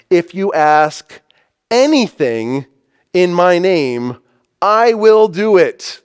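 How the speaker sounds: slow, building promise, ending high and loud on "I will do it"; emphatic. Retold or imitated. Preacher quoting Jesus' promise to the disciples, emphatic. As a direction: weigh each phrase, then drive home "I will do it". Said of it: "Emphatically, Jesus told them".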